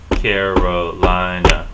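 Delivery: "Carolina" stretched into four slow, loud beats; slow, evenly beaten-out "Carolina"; syllable by syllable. Shown as a demonstration. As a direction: tap out each syllable of "Carolina". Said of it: "tap it out on the table".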